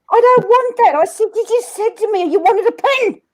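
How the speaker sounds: high, loud indignant refusal; imitating an annoyed friend; retold indignation. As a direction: reject it, pitched right up. Friend rejects the wrongly fetched pin.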